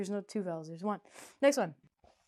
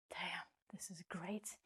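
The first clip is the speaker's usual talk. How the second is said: much softer, unvoiced whisper; whispered aside; 3 semitones lower, 15 dB quieter than usual. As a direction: whisper it to yourself. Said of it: "whispered self-praise".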